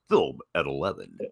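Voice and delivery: deep, dramatic news anchor sign-off; newscaster voice. Pretend. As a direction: drop low like a TV anchor. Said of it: "for the newscaster voice".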